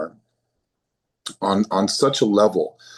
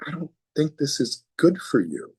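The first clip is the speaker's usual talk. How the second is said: gentle, higher inner voice; sweet little voice; 6 semitones higher than usual. Retold or imitated. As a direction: coax like a kind little inner voice. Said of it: "sweet little voice that goes".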